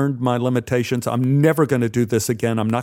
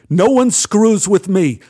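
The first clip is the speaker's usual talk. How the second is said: menacing threat; 7 semitones higher, 6 dB louder, slower than usual. Acted. Gun pressed to nose.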